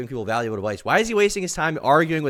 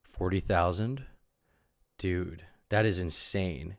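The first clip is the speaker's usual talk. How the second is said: stunned disbelief; 4 semitones lower, 5 dB quieter, much slower than usual. Real, not acted. Reacting to the price.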